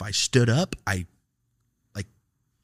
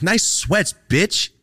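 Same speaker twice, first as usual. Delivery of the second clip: much higher, louder jeer; loud taunt; 10 semitones higher, 7 dB louder, much slower than usual. Retold or imitated. Repeating the parting shot at the teacher, louder jeer. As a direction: really sell the insult.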